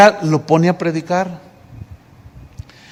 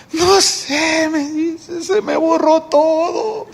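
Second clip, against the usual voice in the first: higher, slower, small and ashamed; high meek voice; 10 semitones higher, much slower than usual. Retold or imitated. Brother admitting he went blank, meek high voice.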